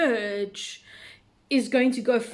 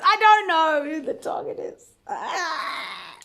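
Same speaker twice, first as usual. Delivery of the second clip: mock crying; 5 semitones higher, slower than usual. Overwhelmed by guessing the target.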